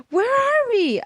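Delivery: high yelled question. A narrator's reading. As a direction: call out to dad over the noise. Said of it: "I yelled to America".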